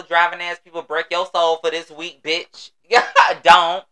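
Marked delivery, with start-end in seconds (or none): none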